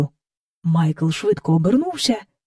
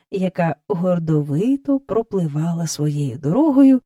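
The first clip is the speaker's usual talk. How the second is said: ordinary delivery, nothing marked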